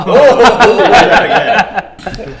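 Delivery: loud laughing yeah. Real, not acted. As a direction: burst out laughing at the echo. Laughs at the echo.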